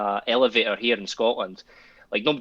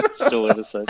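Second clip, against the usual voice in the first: laughing; 12 semitones higher than usual. Real, not acted. Laughing at the story.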